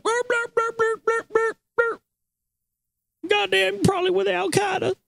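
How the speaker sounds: very high-pitched cartoonish garbled grumbling; hammed-up high-pitched rant; mock ranting customer. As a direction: imitate a worked-up customer, squeaky but not loud. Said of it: "he's getting all loud".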